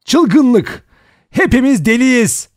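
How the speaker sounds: high, loud hysterical shouting; loud, high-pitched outburst; yelling. A narrator's reading. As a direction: yell it out, nerves breaking. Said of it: "diye bağırdı".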